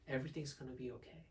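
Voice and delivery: low-key, soft reassurance; calm and quiet, at ease; relaxed. Shown as a demonstration. Coach repeating a line to show the relaxed tone, relaxed. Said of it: "I'm relaxed".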